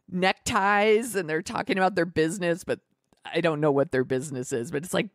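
usual speaking voice

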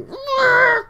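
high, loud strained squawk; weird strangled cry. Retold or imitated. Streamer imitating the odd noise they made, strangled squawk. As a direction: mimic your own weird noise with a squawk. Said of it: "That sounded really weird, like".

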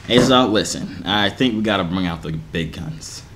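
very low, grave voice; deep voice. Pretend. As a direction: drop to a deep, serious register. Dropping into deep voice as coach.

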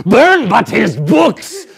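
loud, slow, urgent command; fierce urging. Acted. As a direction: hammer the order home. Caliban urging murder.

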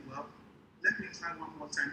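ordinary delivery

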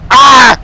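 sudden loud yell of pain; pained shout. Real, not acted. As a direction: cry out as you get hit. Hit in game.

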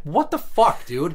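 sharp, high disbelieving outburst; retold exasperated what-the-fuck; incredulous. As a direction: throw it out in stunned disbelief. Retelling his reaction to vague complaint.

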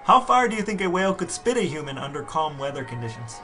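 ordinary talk, nothing marked

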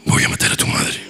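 susurro grave y ronco; voz grave de muñeca. Shown as a demonstration. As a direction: amenaza de muñeca poseída, susurrada. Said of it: "Una muñeca con voz grave". (muñeca poseída con voz grave)

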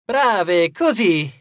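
rousing showman's shout; exclaimed praise. A narrator's reading. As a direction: urge the crowd on. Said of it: "esclamò".

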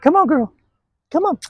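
high-pitched call to a dog; high, short encouragement; coaxing the dog. Real, not acted. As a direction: urge the dog on in a lifted voice.

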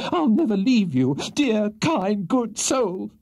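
high, impassioned clinging cry; swooning widow in hysterical raptures; frantic. A narrator's reading. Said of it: "exclaimed Missus Bardell frantically".

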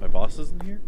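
real surprise; surprised. Real, not acted. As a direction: blurt in disbelief.

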